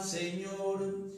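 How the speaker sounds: ordinary talk, nothing marked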